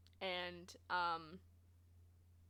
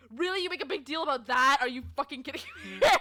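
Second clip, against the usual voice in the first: exasperated outburst; 3 semitones higher, 7 dB louder than usual. Real, not acted. Exasperated rant about the customer.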